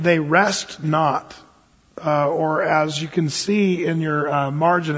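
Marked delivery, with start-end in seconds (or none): 0.0-1.3 slow, deliberate scripture quote; quoting the verse, slowly. Shown as a demonstration. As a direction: lay out each word, slowly. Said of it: "it says that in the middle".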